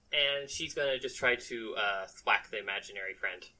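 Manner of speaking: normal talking voice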